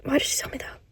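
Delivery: hushed, breathy whisper. Real, not acted. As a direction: whisper it in disbelief. Whispers the sad news.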